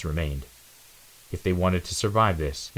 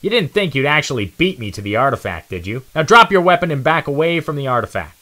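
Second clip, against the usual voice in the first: higher, louder bratty taunt; gloating rival; 8 semitones higher, 8 dB louder than usual. Acted. Nitro orders Zero to drop his weapon, louder gloating. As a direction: lord it over him. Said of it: "Zero did as he was told".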